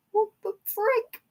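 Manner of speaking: high, slow bewildered squeal; high panicked squeak. Retold or imitated. Fan panicking at an unscheduled match, high slow squeal.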